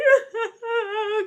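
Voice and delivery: a high, teary laugh-sob; laughing as the crying breaks through. Real, not acted. Breaking down on the call.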